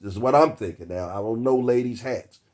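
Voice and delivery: normal talking voice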